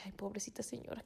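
hushed "pobrecita" to herself; pitying whisper. Retold or imitated. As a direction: breathe it like a secret sympathy. Private pity for the woman.

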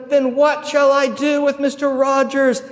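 high, loud, slow question in an official's role; governor calling out. Retold or imitated. Governor to the enraged mob, high, loud proclaimed question. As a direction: project it slowly over a roaring crowd.

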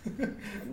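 short, soft unvoiced chuckle; a brief laugh at the comparison; quick quiet chuckle; amused laugh. Real, not acted. Amused by Dark Souls comparison.

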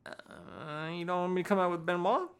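high, hesitant retold question; put-on high voice, quoting a question; imitating Paul Heyman. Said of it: "and he's like".

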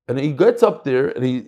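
ordinary delivery

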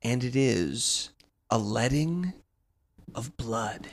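slow, grand preaching voice; solemn sermon. Acted. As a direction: preach it slowly like scripture. Solemn wrestling sermon closer.

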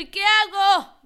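high, loud frantic cry; panicked shriek. Pretend. Dog owner panicking over fever, high loud shriek.

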